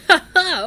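high, loud mocking "ha ha"; hammy villain laugh; gangster's laugh. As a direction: laugh off a refusal like a thug. Gangster laughs off her refusal.

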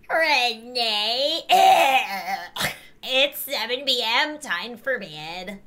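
grandma voice. Acted. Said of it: "I don't know what voice this is".

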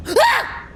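high sudden shriek of fright; startled scream. Real, not acted. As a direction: yelp at the jump scare. Jump scare.

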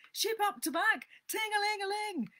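high, loud captain's orders and bell; play-acted steamboat commands; boy playing steamboat captain. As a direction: call out orders, then trill the bell up high. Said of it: "He was boat and captain and engine bells combined".

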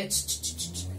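string of short unvoiced "ch" hushes; scolding tutting shush. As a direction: cut them off with repeated sharp shushes. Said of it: "viene la señora gramática y le dice".

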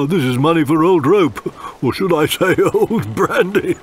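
high pirate captain chuckling through words. Acted. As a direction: gloat over the loot with a laugh. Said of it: "chuckled Pugwash".

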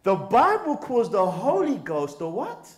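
rousing call for the answer; getting excited. Real, not acted. Preacher prompting the brothers about the Holy Ghost, excitedly. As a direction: repeat the question with rising excitement. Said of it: "I'm getting excited now".

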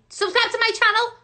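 fast, high, loud shout; sudden high, quick eureka; excited realisation. Real, not acted. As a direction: shout out the answer as it dawns. Suddenly gets the answer.

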